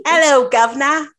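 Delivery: a loud Cockney greeting. Shown as a demonstration. Showing off a Cockney accent after the British film, loud Cockney. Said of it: "Cockney, yes".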